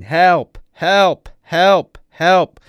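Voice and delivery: high, very loud repeated screams; full-voiced cries for rescue; screaming for help. A narrator's reading. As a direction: scream it out in rising panic. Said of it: "continues screaming".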